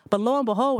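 ordinary delivery

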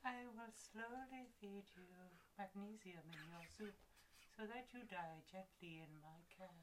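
a seductive Scottish secretary, softly menacing. Acted. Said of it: "You sound a little bit like".

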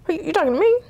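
high, incredulous "who, me?" squaring up; playful, high-pitched confrontational clapback; mock challenge. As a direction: act like you're ready to fight a mouthy kid. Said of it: "I wanted to be like".

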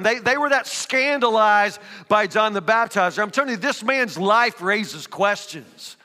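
normal talking voice

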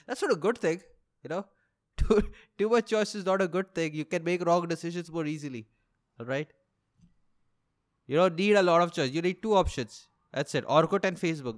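usual speaking voice

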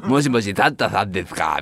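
put-on low, soft kid on the phone; shy child calling. (電話する子供の声)

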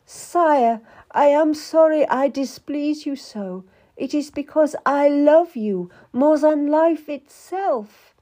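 high, pleading declaration of love; tearful declaration. A narrator's reading. Wife apologising to the king who scolded her, tearfully pleading. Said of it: "she had wept when he spoke, and had declared her love".

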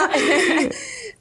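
a high, bright burst of real laughter. Real, not acted. Laughing at her own carefree answer to the hosts, a real high laugh.

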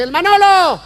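high loud shout; crowd shout. Acted. Whole audience yells his name, high and loud. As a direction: everyone yells the name at once. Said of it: "se para todo el público y dice".

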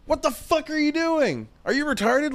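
high, mock-furious yell at another driver; retold road rage yell. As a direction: imitate yourself raging behind the wheel. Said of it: "And I'm sitting there like".

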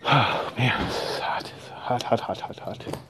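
breathless whisper about the heat. Real, not acted. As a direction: whisper it under your breath. Muttering to himself in the heat.

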